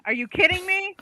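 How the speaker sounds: high, incredulous squeal of disbelief; shocked disbelief. Retold or imitated. Wife recalling her shock at his stunt, high disbelief. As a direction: act out her stunned reaction to the news.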